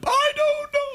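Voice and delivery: high falsetto woman's voice; crying wife in a squeaky high voice. Retold or imitated. Wife telling husband he did nothing, in falsetto.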